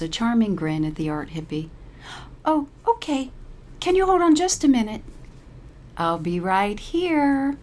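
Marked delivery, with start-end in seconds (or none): 2.4-5.0 very high, fast flustered reply; flustered instructor. A narrator's reading. Instructor caught off guard. 6.0-7.6 slow, sing-song sweet reply; chirpy. A narrator's reading. Paralee chirps sweetly at the instructor, slow and sing-song. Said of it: "Paralee chirps".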